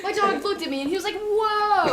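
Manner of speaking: fast, high, loud imitation through laughter; giggly mock retelling; laughing mimicry. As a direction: parrot the friend's line, cracking up. Mimics friend's line while laughing.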